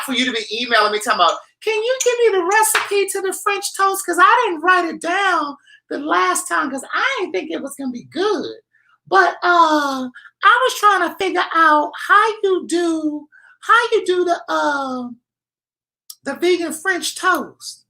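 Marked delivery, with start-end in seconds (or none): 1.6-8.6 high falsetto whiny fan voice; squeaky mock-begging voice; mock needy viewer. Mocking viewers' emails in falsetto. 9.1-17.8 high falsetto whiny fan voice; squeaky mock-begging voice; mock needy viewer. Continues mocking viewer request.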